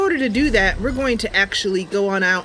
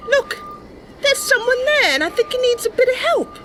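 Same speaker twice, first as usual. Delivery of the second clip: put-on much higher, eager young Bosmer girl; Mercy's voice. Mercy telling her cat Sasha about the stranger, high eager voice. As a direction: lift way up into a sweet, earnest young knight-hopeful.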